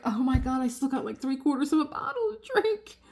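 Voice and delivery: high, exasperated retold panic; dismayed panic. Drinker seeing the bottle still mostly full, dismayed panic. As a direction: replay the dismay, voice climbing high.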